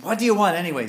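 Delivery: high, loud exasperated question; irritated demand. Retold or imitated. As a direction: demand it like a disturbed old man. Annoyed old man answers pestering boys.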